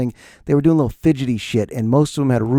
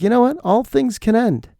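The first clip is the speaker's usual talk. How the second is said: quoted declaration; 10 semitones higher than usual. Retold or imitated. Podcaster quoting another host on quitting, high-pitched declaration. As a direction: retell it as a high, slowed pronouncement. Quoting Marc Maron's decision to stop.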